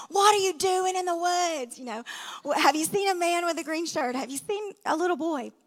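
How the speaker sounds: put-on high, urgent demanding of the teenagers; frantic questioning. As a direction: grill them like a panicked father. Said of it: "He's like".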